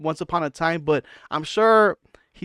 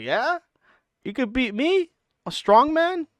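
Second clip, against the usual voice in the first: high, rising disbelieving questions; imitating a sceptical strongman, pitched way up; mock incredulity; 9 semitones higher than usual. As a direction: climb higher with each doubting question. Imagining Thor doubting Brendan's boast.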